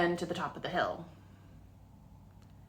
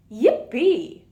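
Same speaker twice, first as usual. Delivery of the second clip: gleeful cheer; 5 semitones higher, 10 dB louder than usual. A narrator's reading. Raccoon cheers during the chase.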